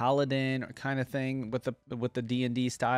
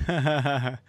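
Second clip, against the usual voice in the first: short laugh; 3 semitones higher, 4 dB louder than usual. Real, not acted. Delighted by the tiki bar.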